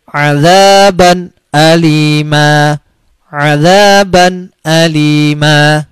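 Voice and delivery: very slow azaban alima, the n crisp before alif; clear tanwin. Shown as a demonstration. Teacher reciting the tanwin example slowly and clearly. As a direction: stretch azaban alima, no hum. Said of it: "Cara bacanya begini".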